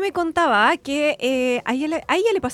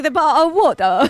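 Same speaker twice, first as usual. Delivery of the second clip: a mock British accent, a bit louder; 3 semitones higher, 6 dB louder than usual. Co-host jokingly mimicking a British accent, a bit louder. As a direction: toss off a mock British phrase. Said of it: "I was like".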